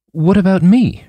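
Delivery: nervous, wanting in; anxious. Acted. Reggie anxious.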